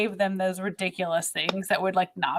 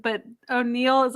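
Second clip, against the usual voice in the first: normal talking voice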